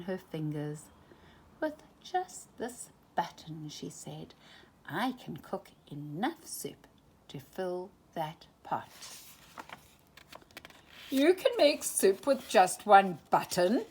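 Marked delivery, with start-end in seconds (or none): 1.6-8.9 softer, teasing and secretive. A narrator's reading. Daisy shows the button. 11.1-13.8 louder, incredulous miser; doubtful surprise. A narrator's reading. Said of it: "said Scrooge".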